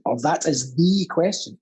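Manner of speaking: "the" drawn into a stressed "thee". Shown as a demonstration. Actor offering another Hamlet reading, stress on "the". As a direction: lean on "the" as the key word. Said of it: "Open to interpretation there".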